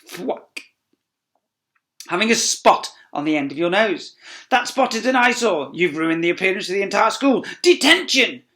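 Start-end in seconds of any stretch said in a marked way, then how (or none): 4.5-8.4 high, loud furious teacher; spitting rage. Acted. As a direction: spit the words out in disgust. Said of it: "she spit".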